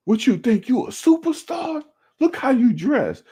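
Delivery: very high, spit-flying rant; mock coach screaming. Coach berating player for dressing like a superstar, high mock scream. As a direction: imitate a coach screaming himself hoarse. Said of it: "spit going everywhere. He lost his voice".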